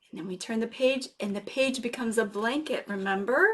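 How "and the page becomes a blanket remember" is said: usual speaking voice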